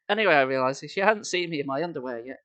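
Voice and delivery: ordinary delivery, nothing marked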